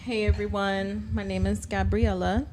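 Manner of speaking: normal talking voice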